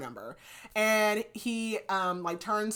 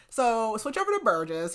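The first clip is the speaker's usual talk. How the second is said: normal talking voice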